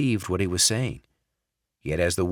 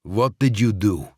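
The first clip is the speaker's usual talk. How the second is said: louder, hard demand in a Russian-boss voice; suspicious Russian boss; 2 semitones higher, 5 dB louder than usual. Acted. Sokolov suspicious, confronting Barrett. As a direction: accuse him coldly. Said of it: "something was amiss".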